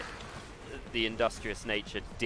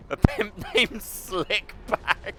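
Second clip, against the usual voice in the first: high, slow words breaking into real laughter; incredulous, high-pitched laughing fit; cracking up; 8 semitones higher, much slower than usual. Real, not acted. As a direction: laugh helplessly through the words. Cracks up at suggested topic.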